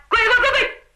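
high, insistent shouted command; crowd shouting. A narrator's reading. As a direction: bark it out as a chanting crowd. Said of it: "两边人高喊".

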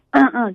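sharp demo throat clear. Shown as a demonstration. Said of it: "这样清嗓子". (清嗓子示范)

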